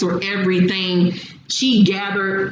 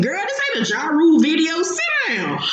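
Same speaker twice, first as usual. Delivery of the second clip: scolding; 9 semitones higher, faster than usual. Retold or imitated. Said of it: "I'm like".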